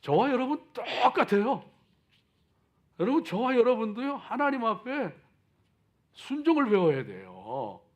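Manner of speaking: loud, high sermon fervor; booming motivational speech. Acted. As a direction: preach it out full force. Preaching at full force.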